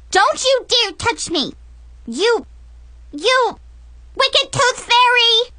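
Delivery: put-on very high, shrill kid scream; little girl screaming. Little Brianna screams at the tooth fairy, very high. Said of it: "Brianna screamed at the top of her lungs".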